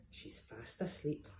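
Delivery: 2 hushed, slow whisper; 3 whisper so nobody wakes her. Acted. 4 hen telling the thieves the snurd sleeps, whisper.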